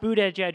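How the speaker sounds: "boot edge edge" as three separate English words; spelled-out pronunciation guide. Shown as a demonstration. Host mocks candidate's own guide, "boot edge edge" sounded out. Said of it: "description of how to pronounce his own".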